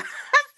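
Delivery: high burst of real laughter. Real, not acted. Mother joining her laughing son, one high burst of laughter.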